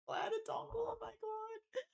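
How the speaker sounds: barely getting words out between giggles; squeaky laughing. Real, not acted. Streamer laughing at chat, high squeaky giggle.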